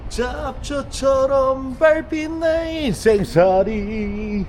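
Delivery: sway through it like a tipsy man; fake drunk. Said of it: "술 취한 척도 할 수 있으니까요".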